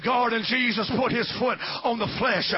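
high, loud shouted preaching over music; full-force preaching. Acted. Pastor urging the congregation to rise, shouted preaching. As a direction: preach it out to the whole church, climbing.

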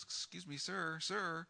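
high, soft imitation of a lady calling; little voice. Lady approaching a man who is packing up, little voice. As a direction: lightly voice a small, high plea for attention. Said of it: "I hear this little voice".

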